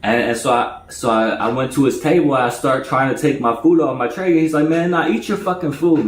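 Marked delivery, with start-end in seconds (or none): 4.7-6.0 higher, insistent push. Retold or imitated. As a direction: refuse firmly but kindly. Insisting he keep food.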